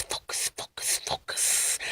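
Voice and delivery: hushed whispered focus. Real, not acted. Whispering to herself to stay focused.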